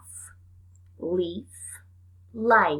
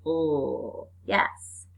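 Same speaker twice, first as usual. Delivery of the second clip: ordinary talk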